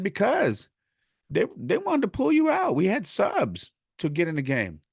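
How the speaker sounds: high, loud, worked-up coach; impassioned retold explanation; emphatic explaining. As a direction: make the case with pitch jumping high.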